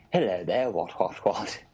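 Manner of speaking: hammed-up old-fashioned English accent. Mocking an Englishman abroad, in caricature English accent. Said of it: "a man from England".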